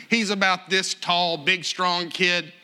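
usual speaking voice

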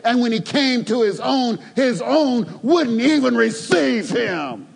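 high, loud preaching run; preaching at full force. Acted. Preacher driving the congregation toward the climax, full-force preaching. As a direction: build the sermon to its peak.

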